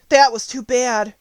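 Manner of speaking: lightly voiced girl Dorothy; Dorothy's voice. A narrator's reading. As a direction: voice Dorothy as a bright little girl.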